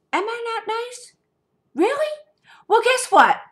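very high, mock-innocent am I not nice; sing-song sarcasm before the blow; sarcastic retort. Throwing the ex's complaint back at him, mock innocent.

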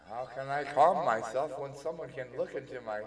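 loud, high, flustered protest; worked-up outburst at full volume; not calm, agitated. Acted. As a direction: wail it in agitation. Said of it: "Neptune doesn't sound very calm".

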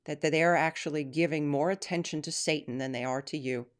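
earnest prayer voice, softening to the end. Acted. Closing prayer for listeners.

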